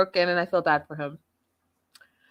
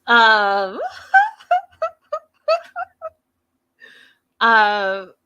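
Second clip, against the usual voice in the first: laughing; 3 semitones higher, 8 dB louder than usual. Real, not acted. Laughing after fake cough.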